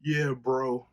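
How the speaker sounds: lightly voiced bro impression. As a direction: toss it off like a laid-back frat bro. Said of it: "Like a".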